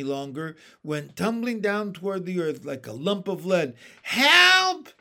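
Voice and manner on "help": high loud cry for help. Acted. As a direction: scream it in panic. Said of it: "cried the centipede".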